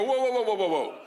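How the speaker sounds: rapid string of whoas, starting high; alarmed halting. Retold or imitated. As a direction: hit the brakes on someone too eager. Halting the eager trainer.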